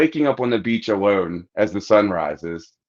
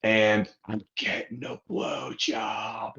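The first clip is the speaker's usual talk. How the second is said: hushed; 9 dB quieter, slower than usual. Retold or imitated. Friend on phone, hushed.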